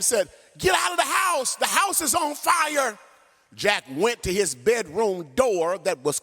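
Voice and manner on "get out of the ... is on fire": much higher frantic shout; screaming father. Retold or imitated. Father screaming at his son in the burning house. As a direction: yell it up at the window. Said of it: "was screaming at him".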